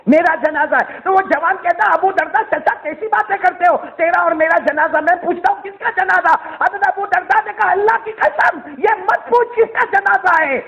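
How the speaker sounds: high-pitched, forceful sermon delivery; ringing, urgent storyteller-preacher; impassioned preaching. Acted.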